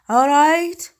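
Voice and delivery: loud, ringing call from inside a bottle; big hollow genie shout. A narrator's reading. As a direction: boom it triumphantly. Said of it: "قال بصوت أجوف".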